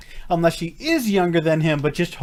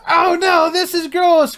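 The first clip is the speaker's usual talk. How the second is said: much higher, louder mock disgust; mock squeal; 12 semitones higher, 6 dB louder than usual. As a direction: shriek it in fake horror. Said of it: "Nobody goes".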